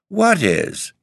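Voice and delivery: plain reading voice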